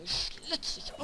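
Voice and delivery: unvoiced high menacing threat; menacing whisper. Real, not acted. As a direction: hiss the threat. Same threat, breathy.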